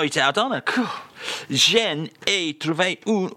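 ordinary talk, nothing marked

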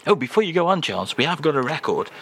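normal talking voice

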